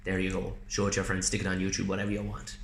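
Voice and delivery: softly quoted, casual producer's go-ahead; offhand low-key offer. Retold or imitated. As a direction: drop the voice, casually hand it over. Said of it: "He went".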